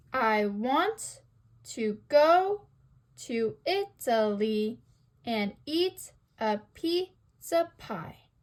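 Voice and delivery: "I want to go to Italy and eat a pizza pie" in slow, separated da-DUM beats; iambic scansion. Shown as a demonstration. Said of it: "Notice how it's unstressed, stressed".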